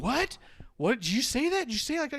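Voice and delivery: high, incredulous repeated what; a lightly mocked stunned crowd; mock disbelief. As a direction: squeak out disbelief. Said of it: "And they going".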